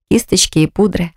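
usual speaking voice

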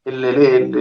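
ordinary talk, nothing marked

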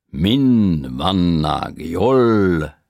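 high, slow, loud foreign tenor mangling Hungarian; a foreign tenor groping for Hungarian words, drawn out; broken Hungarian. A narrator's reading. Tenor answering grandparents in broken Hungarian. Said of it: "mondta tört magyarsággal a tenorista".

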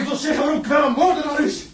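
loud, high insistent yells; desperate shouting. Acted. Prisoner shouting at the guard who tells him to calm down, desperate shouting. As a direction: keep hammering the demand.

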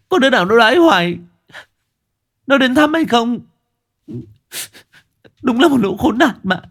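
pent-up hurt spilling over at the village gossips; aggrieved outburst. A narrator's reading. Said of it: "dường như ấm ức quá, cô đã thút thít". (bức xúc, uất ức, cao và to dần)

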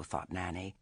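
usual speaking voice

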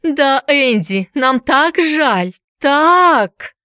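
high, drawn-out jeering imitation; hammed-up taunting whine; mocking mimicry. A narrator's reading. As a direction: exaggerate her apology, slow, high and loud. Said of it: "кривляясь, передразнил её Эллиот".

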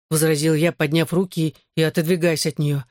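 ordinary delivery

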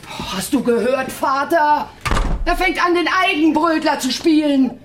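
high, loud ranting. Acted. Mother yelling to father about son. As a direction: yell it across the room. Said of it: "Und Mutter lärmte weiter".

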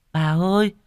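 put-on high, loud little-boy voice; whining child insisting. A narrator's reading. Boy swears to grandma he saw Tài at the gate, whiny insistence. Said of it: "Nó mếu máo khẳng định lại".

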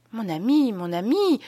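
gushing, adoring wife's voice; admiring, lilting appeal; overflowing admiration. A narrator's reading. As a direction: swoon with admiration, drawing out the words. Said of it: "qui débordait d'admiration".

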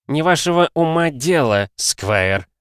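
snappish. Acted. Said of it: "сварливо отрезал Ксарберус".

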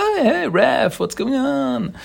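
high, drawn-out jovial hail; Casey's greeting. Retold or imitated. Casey hails Rev coming through the window, high jovial greeting.

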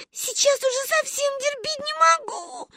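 very high, whiny crying; sobbing child. A narrator's reading. Granddaughter desperate for the toilet, sobbing. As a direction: whine through tears like a little girl. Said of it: "рыдала Гайне".

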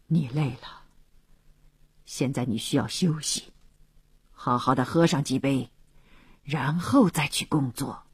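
low, gentle, hushed reassurance; soft-spoken. A narrator's reading. Colonel soothing a worn-out Philip, soft-spoken. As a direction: speak low and softly to soothe. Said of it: "轻声地说".

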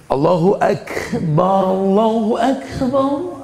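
mock showy call to prayer; showing-off adhan. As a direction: belt it out to impress the people walking in. Showy call when people enter.

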